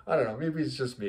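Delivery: ordinary talk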